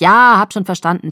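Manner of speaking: loud, irritated interruption; sharp, fed-up retort; angry cut-off. A narrator's reading. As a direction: cut the other person off angrily. Angry caller cuts her off.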